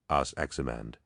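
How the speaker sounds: ordinary delivery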